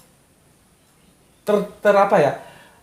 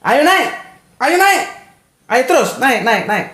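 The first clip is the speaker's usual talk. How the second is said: Betawi parent calling; 8 semitones higher than usual. Retold or imitated. Betawi parent calling up to the child in the tree, high mock dare. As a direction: sing out the dare like a Betawi mum daring her kid. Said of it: "dia bilang apa?".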